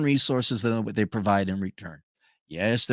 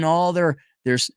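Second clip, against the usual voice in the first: usual speaking voice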